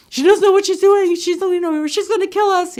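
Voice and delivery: high, loud panicked yell; yelling uncle. Retold or imitated. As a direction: shout it into the kid's ear in panic. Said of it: "the uncle is just yelling".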